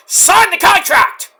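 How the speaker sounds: loud, high, drawn-out command; retold shouted order. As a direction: demand it at the top of your promo voice. Retold furious order to Kevin.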